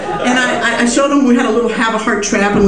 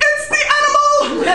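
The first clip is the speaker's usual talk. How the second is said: panicked. Retold or imitated. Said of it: "He goes".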